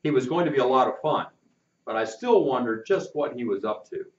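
plain reading voice

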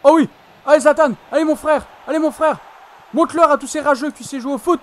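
loud high cheering; excited sports commentary. Real, not acted. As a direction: cheer the striker on. Cheering Zlatan's goal.

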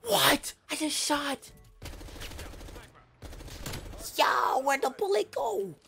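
high, loud, disbelieving shout, slowing down; outraged yell. Real, not acted. Gamer raging at a shot that missed, high loud slow shout. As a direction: scream at the vanished bullet.

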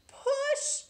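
high, loud straining cry; effortful shout; straining push. Acted. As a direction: push hard like laying an egg. Straining to lay egg.

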